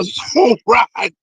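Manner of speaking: ordinary talk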